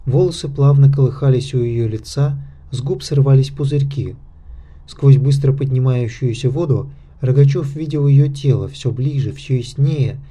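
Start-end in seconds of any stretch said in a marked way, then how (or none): none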